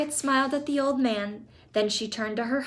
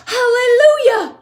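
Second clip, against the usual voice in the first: very high, loud cry of joy; joyful yell; 14 semitones higher, 16 dB louder than usual. Acted. Grandpa Joe celebrating the trip, very high loud yell. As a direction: shout it to the heavens. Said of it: "yelled Grandpa Joe".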